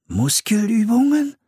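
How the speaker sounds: high-pitched startled question; alarmed thought, pitched right up. A narrator's reading. Boy alarmed at what he thinks he heard, startled. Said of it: "dachte Philipp erschrocken".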